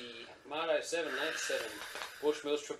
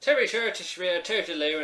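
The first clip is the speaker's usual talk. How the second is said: bad Irish accent; 6 semitones higher, 8 dB louder than usual. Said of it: "In a very bad Irish accent".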